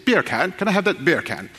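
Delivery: put-on English accent. Shown as a demonstration. Preacher modelling a phrase for the congregation, English accent. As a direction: ask like a mock Englishman. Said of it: "As an Englishman".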